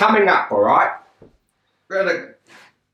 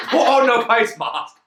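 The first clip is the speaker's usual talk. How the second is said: Paul Chowdhury; 7 semitones higher, slower than usual. Retold or imitated. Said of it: "Now I'm fucking Paul Chowdhury".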